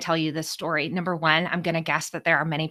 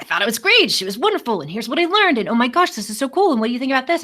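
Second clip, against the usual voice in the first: high, loud, bubbling gush; 7 semitones higher, 6 dB louder, faster than usual. Retold or imitated. Gushing to her boss about the session. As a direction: spill over with excitement. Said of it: "I start going on and on".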